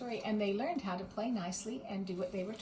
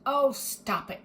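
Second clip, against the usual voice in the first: grumbling; 4 semitones higher, 7 dB louder, slower than usual. A narrator's reading. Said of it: "grumbled Little Bear".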